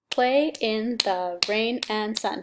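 "Play in the rain and sun" split slowly into six beats; slow syllable count. Shown as a demonstration. Teacher counts syllables for the class, slow and even. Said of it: "That's the six syllables".

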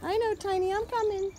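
waiting dog Tiny is soothed in a high coo; high pet talk. Real, not acted. Reassuring the dog.

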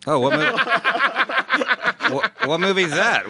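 high, laughing through the words; helpless giggly curiosity; laughing question. Real, not acted. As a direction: crack up while asking, slowing as you go. Cracking up at bad film confession.

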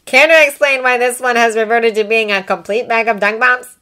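inquiring. A narrator's reading. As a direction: demand an explanation tartly. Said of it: "she inquired".

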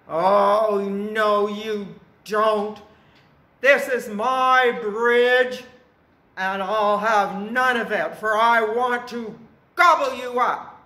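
very loud, stretched-out troll refusal; threatening troll. A narrator's reading. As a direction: bellow like a greedy bridge troll.

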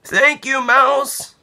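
high, bright thank-you from Rabbit; grateful Rabbit. Acted. Rabbit thanking his friend Mouse, high and happy. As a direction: beam with thanks.

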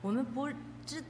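ordinary talk

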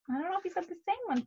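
plain reading voice

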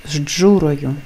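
«з джурою» starting on zh before dzh; assimilated pronunciation. Shown as a demonstration. Teacher gives an example of the rule, assimilated. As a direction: say «з джурою» as zh-dzhuroyu, run together. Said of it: "звучить як".

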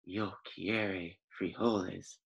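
low, soft, slow solemn words; a sombre, hushed chihuahua leader; somber declaration. Acted. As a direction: declare it gravely and slowly. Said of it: "in his most somber voice declared".

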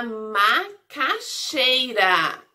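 "macaxeira" slow, each syllable set apart. Shown as a demonstration. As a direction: sound out "macaxeira" with small pauses inside. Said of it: "começa com a sílaba ma".

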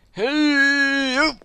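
loud high shout. Real, not acted. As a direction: yell to get attention. Calling out to a character.

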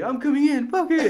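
high, laughing declaration; desperate resolve. Retold or imitated. Boy to brother in the shower, high laughing resolve. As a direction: burst in, giving up on waiting. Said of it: "And I was like".

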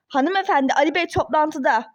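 ordinary talk, nothing marked

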